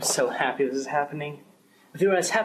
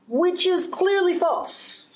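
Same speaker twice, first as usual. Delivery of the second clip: much higher, slow, scornful jab; indignant accusation; much slower than usual. Acted. Official accusing the union guard, high and scornful. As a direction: lay the lie bare with contempt.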